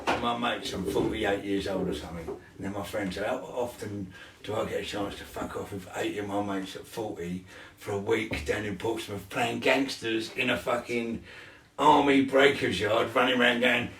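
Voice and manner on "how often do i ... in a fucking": ordinary delivery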